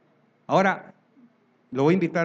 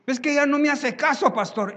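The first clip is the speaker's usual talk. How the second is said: much higher, whiny complaint; mock complaining husband; 9 semitones higher, 4 dB louder than usual. Husband complaining to the pastor about his wife, high whine.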